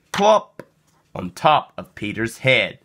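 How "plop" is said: a comic splat; high loud plop. A narrator's reading. Reader to child, a loud high comic plop. Snow lands on Peter's head.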